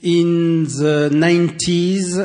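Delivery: ordinary talk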